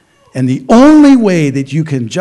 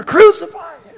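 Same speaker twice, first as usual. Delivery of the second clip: much higher, louder call; crowd calling out; 11 semitones higher, 3 dB louder than usual. Retold or imitated. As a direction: shout it from somewhere in the crowd. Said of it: "coming from somewhere".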